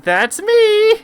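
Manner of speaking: put-on very high, loud squeal of a little girl; a tiny girl proudly shouting; excited little girl.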